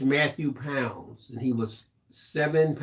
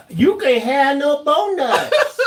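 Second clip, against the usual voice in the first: loud giggling "bonuts" with a b; laughing mock mispronunciation; 9 semitones higher, 6 dB louder than usual. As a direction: tease her "bonuts" through laughter. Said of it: "finish eating before you start trying to talk".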